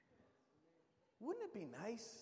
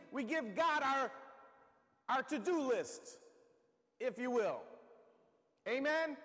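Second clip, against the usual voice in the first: preaching; 6 semitones higher, 6 dB louder than usual. Acted. Preacher leads prayer in sermon.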